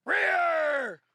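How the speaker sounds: loud high commands to the class; shouted drill calls. Real, not acted. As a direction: call each position out hard. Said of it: "calling drill positions".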